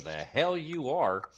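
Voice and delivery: slow, firm refusal; stern gnome. Acted. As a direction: lay it down slowly and firmly. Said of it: "at you and goes".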